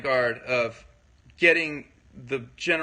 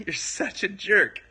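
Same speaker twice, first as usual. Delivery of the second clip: slow playful jab with a grin, higher than usual; friendly teasing insult; 5 semitones higher than usual. Shown as a demonstration. Demonstrating body language to viewers, playful teasing insult. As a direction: say the insult like a joke between friends. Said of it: "That's why I could say something like".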